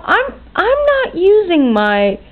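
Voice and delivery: high-pitched indignant protest. Real, not acted. Customer refusing to use debit card for museum, indignant. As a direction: refuse with real outrage, pitch soaring.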